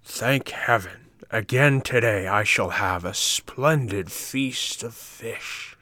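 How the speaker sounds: a pleased, slow fisherman's voice; satisfied exclamation. A narrator's reading. Green fisherman exclaiming happily over his catch, slow and pleased. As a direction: savour each word with satisfaction. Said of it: "he exclaimed with great satisfaction".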